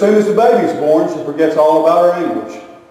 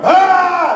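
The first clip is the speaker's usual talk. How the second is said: high mock scream; imitated pain scream; 12 semitones higher, 3 dB louder than usual. Wife in labor as visitors ask, high mock scream. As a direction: let out a short, high labor yell.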